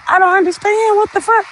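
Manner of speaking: baffled outburst. Acted. Exasperated at evasive answers.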